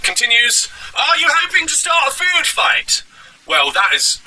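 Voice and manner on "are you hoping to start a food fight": slow, very high mock heckler; Yirmeyahu Weeps. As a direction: taunt rudely in a drawn-out squeaky caricature. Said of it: "how rude he was being".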